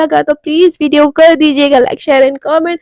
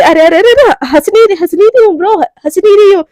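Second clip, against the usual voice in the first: high, loud frantic backpedal; panicked denial; 4 semitones higher, 6 dB louder than usual. Acted. Mishka frantically insists to Romi she isn't laughing. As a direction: talk her down in a hurry.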